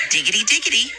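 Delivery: high, laughing singsong; teasing singsong. A narrator's reading. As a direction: tease again with a chant. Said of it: "Heather repeated".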